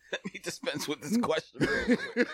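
high, laughing retelling of a private thought; amused quoted thought. Retold or imitated. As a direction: quote yourself through a laugh. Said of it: "I was like".

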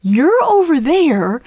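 loud, high, drawn-out calling; imitated yelling across street. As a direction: stretch each phrase out to carry across the street. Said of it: "yelling at her across the street".

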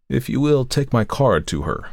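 ordinary delivery, nothing marked

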